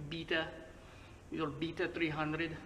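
poor mock Australian accent, "Beta" as BEE-tuh; attempted South African-Australian accent. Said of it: "I don't know what accent that was".

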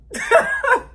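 high sudden laugh. Real, not acted. Vlogger laughing while signing off to viewers. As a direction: breaks up laughing mid-sentence.

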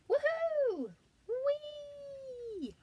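high, loud joyful whoop; gleeful whoops. Acted. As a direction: squeal with delight down the slide. Bear whizzing down slide.

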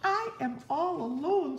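high panicked wail; screaming. Acted. Toad alone on the sled, high mock scream. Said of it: "screamed Toad".

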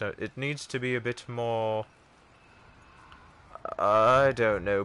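ordinary delivery

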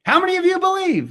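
high showman's call to the crowd; rousing showman call. Retold or imitated. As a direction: work the crowd. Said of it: "He's a good showman".

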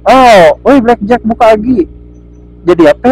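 ordinary talk, nothing marked

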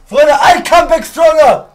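slow, high, emphatic proclamation; triumphant announcement. Real, not acted. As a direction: declare the comeback loudly to your viewers. Announces comeback to chat.

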